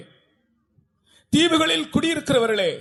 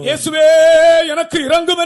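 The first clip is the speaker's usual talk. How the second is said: higher, louder pleading call; loud cry for mercy; 6 semitones higher, 8 dB louder than usual. Shown as a demonstration. Preacher showing the congregation how to cry out, loud and higher. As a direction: cry it out to Jesus. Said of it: "சத்தமாய் சொல்ல மாட்டீர்களா?".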